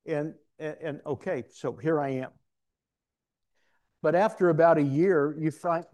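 usual speaking voice

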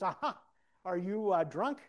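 loud, high, halting retold question; mock-stunned, feeling for the word; comic incredulous friend; imitated disbelief.

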